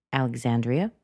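ALEXANDRIA with an upward tail; casual rising intonation. Shown as a demonstration. Answering what's your name, casual upward intonation. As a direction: let "Alexandria" climb at the last syllable. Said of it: "It goes up".

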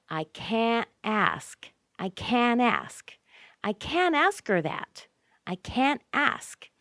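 high, slow "can't" with hardly any t before "ask"; both "can't" and "ask" stressed, t barely there; strong CAN'T. Shown as a demonstration. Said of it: "really strong sound that can't has".

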